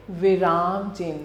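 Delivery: normal talking voice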